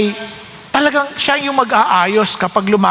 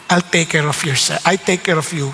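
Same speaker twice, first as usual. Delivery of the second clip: gentle comfort; 5 semitones lower, 3 dB quieter than usual. Retold or imitated. The Lord comforting.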